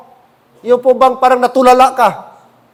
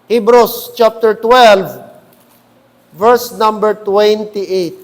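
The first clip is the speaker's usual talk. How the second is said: slow, each word spaced out; slow, deliberate citation; 3 semitones lower, slower than usual. Shown as a demonstration. Pastor to congregation, slowly repeating the verse number. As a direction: spell out "Hebrews chapter twelve" slowly so all can find it. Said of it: "repeats verse slowly".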